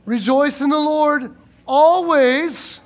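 slow and loud, stretched always; slow proclamation. Real, not acted. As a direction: proclaim it word by word. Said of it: "Turn to your neighbor and say".

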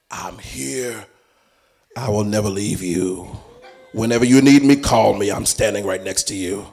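calm and sure, like a faithful protector; lightly voiced comforting God; reassuring God voice. God's reassuring voice.

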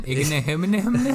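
Igbinoghene as a hummed mumble, "ina-humna-humna"; mocking garbled name. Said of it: "Why would you make fun of his name?".

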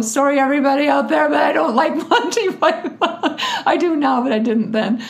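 loud, high, laughing aside; laughing apology. Real, not acted. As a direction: apologise to the audience through laughter.

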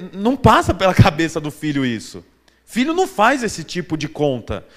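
ordinary talk, nothing marked

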